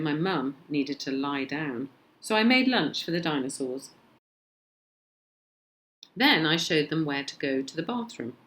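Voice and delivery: usual speaking voice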